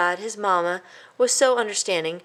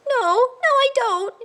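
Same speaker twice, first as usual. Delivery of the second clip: high, louder tearful squeak; unhappy squeak; 5 dB louder, slower than usual. A narrator's reading. A guilty crying mouse squeaks his answer. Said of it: "he squeaked unhappily".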